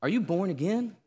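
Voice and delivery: put-on the grandmother springs the question on the boy. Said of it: "grandmother ... looks at me and goes".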